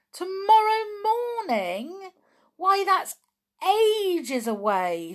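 very high, loud, drawn-out wail; dismayed wail. A narrator's reading. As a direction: wail it in disbelief, stretching the words. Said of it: "said poor George".

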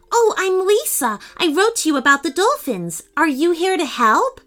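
put-on a girl meeting the governor, eager; eager young girl. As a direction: rush to introduce yourself eagerly. Narrator voices Lisa.

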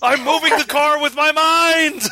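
high and loud triumphant cry; high, loud giddy shout about moving the car; mock ecstatic shout. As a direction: yell it like you can't believe it works. Acted quote, excited shout.

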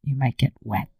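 breathy, naughty whispered tease; cheeky whisper. Acted. Cohost whispers a naughty word to tease her partner.

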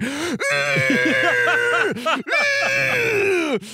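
very high, long mock squeal; drawn-out, high-pitched comic straining sound; imitated straining noise. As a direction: squeal as if pushing out a miracle. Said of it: "pushing his hands up towards the ceiling and going".

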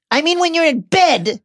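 high mocking snarl. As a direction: snarl it back. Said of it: "Dudley snarled".

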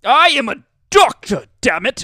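high, loud indignant snob; huffy city doctor shouting; snobby doctor voice. Retold or imitated. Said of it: "the kind of snobby voice I went with earlier".

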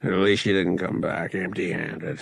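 low, grudging grumble; grumbling. A narrator's reading. Captain inspecting the boy's haul, grumbling. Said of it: "the captain grumbled".

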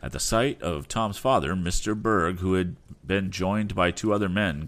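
usual speaking voice

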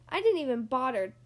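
ordinary delivery

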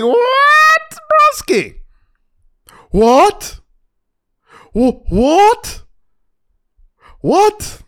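loud, very high-pitched "what?" with rising tune; shocked disbelief. Retold or imitated. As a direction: re-enact being floored, each "what" shooting upward. Retells nearly falling off his seat.

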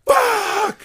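loud high mock agonized shout; straining man's yell. Imitating a stranger straining in the stall, agonized yell. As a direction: let out a hammed-up strained yell. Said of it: "He's like".

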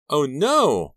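loud, high-pitched cry of dismay; panicked cry. A narrator's reading. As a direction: cry out in horror. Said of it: "Maya cried".

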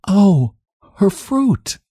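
high, upset "oh, her fruit"; worried cry over lost preserves; dismayed gasp. A narrator's reading. Sheriff's wife dismayed.

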